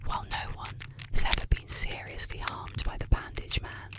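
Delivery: usual speaking voice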